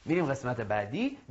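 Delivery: normal talking voice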